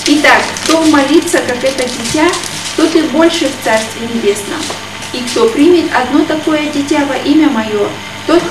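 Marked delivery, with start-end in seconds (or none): none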